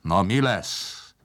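slow, drawn-out impatient demand; restrained booming call to hurry up; shouting. A narrator's reading. Steam man hurries Palkó along, shouting slowly. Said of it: "kiabált a kis emberke".

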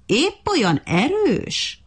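high, slow, swooping sneer; taunting question, stretched and pitched high; mocking. A narrator's reading. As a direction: draw it out in mock wonder. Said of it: "kérdezte gúnyosan a majom".

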